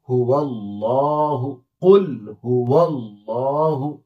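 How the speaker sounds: हुवल्लाहु with वाव flowing into a doubled लाम; joined recitation. Shown as a demonstration. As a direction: join कुल हुवल्लाहु slowly. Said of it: "वाव को मिलाएंगे अल्लाह के लाम के साथ". (हुवल्लाहु मिलाकर)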